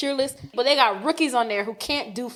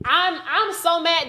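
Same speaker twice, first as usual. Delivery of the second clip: normal talking voice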